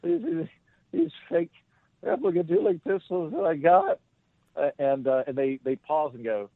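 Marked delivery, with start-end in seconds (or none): 0.0-4.0 nervous. Retold or imitated. Nervous man showing fake pistols.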